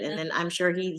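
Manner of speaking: ordinary talk, nothing marked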